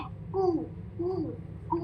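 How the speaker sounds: कू as a long "koo", never "ko"; rounded "oo". Shown as a demonstration. Teacher correcting the vowel for learners, long rounded "oo". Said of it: "को, को वाली sound नहीं है".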